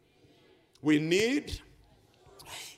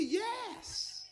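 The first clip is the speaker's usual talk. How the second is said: high, drawn-out yes; playful high-pitched reply; emphatic yes; 13 semitones higher, 6 dB quieter than usual. Retold or imitated. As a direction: answer in a high, softer mock voice. Acted answer to his wife.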